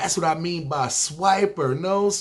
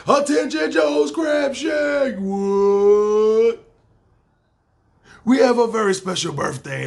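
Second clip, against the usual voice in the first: shouted, much higher attention call; restaurant announcer shout; 4 semitones higher, 5 dB louder, slower than usual. Acted. Announcing a birthday to the whole Joe's Crab Shack, shouting high.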